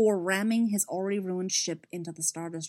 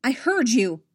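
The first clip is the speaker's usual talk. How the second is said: loud, higher, curt retort; snapped; 5 semitones higher, 7 dB louder, slower than usual. Acted. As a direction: snap back, irritated. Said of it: "Emil snapped".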